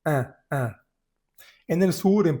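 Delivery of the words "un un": un said as a nasal vowel, no N; un, nasal, no N at the end; French nasal un. Shown as a demonstration. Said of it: "que es".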